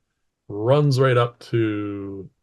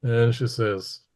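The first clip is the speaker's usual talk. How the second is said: usual speaking voice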